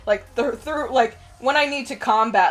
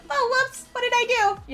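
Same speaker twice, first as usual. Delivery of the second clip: put-on high, clueless little-girl voice; cute elf voice; 13 semitones higher than usual. Elf girl wrecking the campaign, cute cluelessness. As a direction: ask it squeaky and helpless. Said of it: "Such a cute voice".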